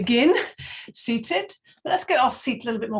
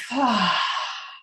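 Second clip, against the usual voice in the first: a long, breathy exhale, letting go; long audible sigh. Shown as a demonstration. Yoga teacher showing the class the breath, long sigh out. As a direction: sigh out through the open mouth. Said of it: "sigh out through the mouth".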